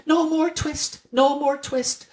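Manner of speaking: very high squeaky chant; twittering mice shout. A narrator's reading. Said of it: "shout at once in little twittering voices".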